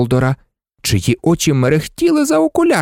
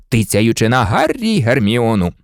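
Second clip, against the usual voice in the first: ordinary delivery, nothing marked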